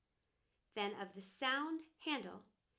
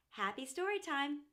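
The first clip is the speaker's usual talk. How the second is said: ordinary delivery, nothing marked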